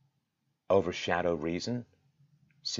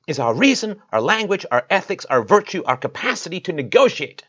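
loud, higher pitched impassioned outcry; frustrated and loud, pitched up in protest; 7 semitones higher, 8 dB louder than usual. Real, not acted. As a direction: raise your voice in real frustrated outrage. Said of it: "I know I sound frustrated".

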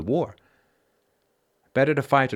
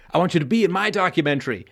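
higher-pitched mimicked plea; imitated eager requesters; 7 semitones higher, 3 dB louder than usual. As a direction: play someone keenly pitching their project. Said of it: "People have said".

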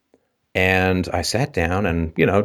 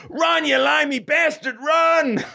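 higher, half-hearted taunting yell of "run"; mock yelling kids; slower than usual. Canadian kids yelling at the English batter, mock jeering shout. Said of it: "all of the Canadian kids are like".

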